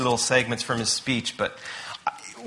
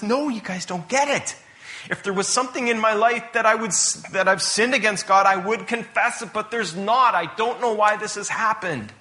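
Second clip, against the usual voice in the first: raised, higher-pitched exasperated denial; heated protest; 7 semitones higher than usual. Retold or imitated. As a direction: protest innocence as a frustrated Job. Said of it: "the more heated Job gets".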